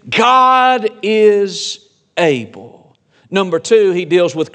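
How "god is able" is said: God is able drawn out very slowly; slow emphasis. Shown as a demonstration. Pastor reassuring doubting church, very slow emphasis. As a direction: land each word of God is able like a promise. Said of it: "Remember this".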